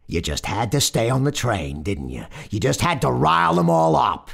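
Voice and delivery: accusing rebuke rising high and loud; angry scolding. A narrator's reading. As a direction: build the blame to a loud finish. Brooklyn motorman scolds passenger.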